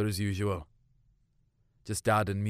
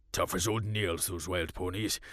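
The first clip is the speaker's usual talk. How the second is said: lower, softer dismissive farmer voice; gruff farmer dad; 6 semitones lower, 6 dB quieter than usual. Acted. Farmer dad brushing off the girls, lower and softer. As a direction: play it down like a weathered old farmer. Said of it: "Dad asked".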